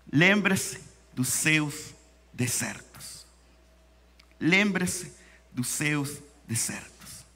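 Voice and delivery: quiet and slow, drawing it out; soft, slow quote. Acted. Preacher quietly repeats the phrase from his Bible. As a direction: let the phrase sink in softly.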